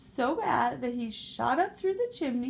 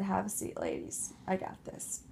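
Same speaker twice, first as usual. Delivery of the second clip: cool confident pig; 4 semitones lower, 6 dB quieter than usual. Acted. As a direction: reassure them with total cool. Adria calm, confident.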